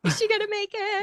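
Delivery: high, anxious-sounding question; mock suspense. Viewer fretting over the stressful ending, high mock suspense. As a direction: pitch it way up, playfully worried.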